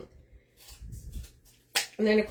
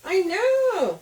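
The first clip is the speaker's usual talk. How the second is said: excited; 9 semitones higher, 4 dB louder than usual. Real, not acted. Greeting viewer excitedly.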